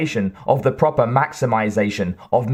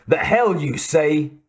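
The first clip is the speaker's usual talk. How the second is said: Western twang; 4 semitones higher than usual. A narrator's reading. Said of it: "accent had a good deal of Western twang".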